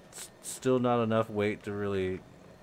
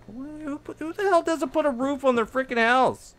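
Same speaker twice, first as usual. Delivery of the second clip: incredulous rant. Real, not acted. Outrage at missing roof.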